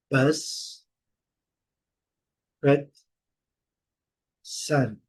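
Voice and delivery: "Bus, cut, sun" each with a short uh; short uh vowel. Shown as a demonstration. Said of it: "Esse som". ("bus" com som de ã curto)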